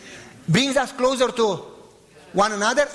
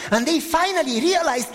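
rising climax shout to the congregation; fervent preaching shout; 8 semitones higher, slower than usual. Real, not acted. Preacher shouting the revelation to the church, fervent.

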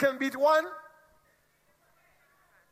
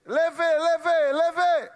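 loud chanted call; loud call to stand; 7 semitones higher, 6 dB louder, much slower than usual. Real, not acted. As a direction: call out leve, leve, leve loudly and higher. Calling the guest to stand.

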